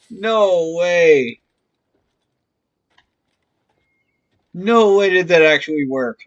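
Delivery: loud, astonished; amazed disbelief. Real, not acted. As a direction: shout it, unable to believe it. Disbelief at glitch working.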